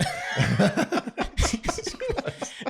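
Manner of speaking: high burst of real laughter; big laugh. Real, not acted. Laughing hard at the buddy's movie pick, big laugh. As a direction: crack up at the movie title.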